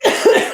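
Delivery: sharp choking cough; coughing fit. Real, not acted. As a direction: cough hard, still choking. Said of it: "it went down the wrong way".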